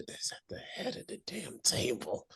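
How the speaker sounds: whisper, biting on damn; whispered aside. Retold or imitated. Griping about Ulf at the table, a sly whisper. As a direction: hiss the damn under your breath.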